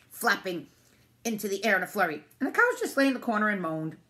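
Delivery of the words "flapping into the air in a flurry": ordinary delivery, nothing marked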